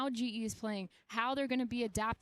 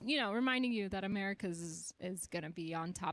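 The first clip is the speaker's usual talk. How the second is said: ordinary delivery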